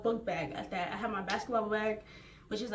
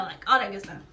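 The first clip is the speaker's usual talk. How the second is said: ordinary delivery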